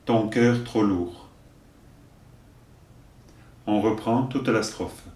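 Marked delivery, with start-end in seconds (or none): 0.0-1.3 slow, deliberate verse delivery; poem recitation. Acted. Teacher giving verses for learners to repeat, measured recitation. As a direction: recite line by line, leaving room to repeat.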